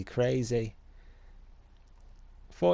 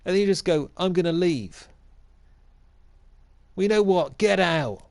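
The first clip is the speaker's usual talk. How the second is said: higher, heated "get out"; mock furious rant; 6 semitones higher than usual. Mock manager ordering his departing player out. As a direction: throw the player out in a huff.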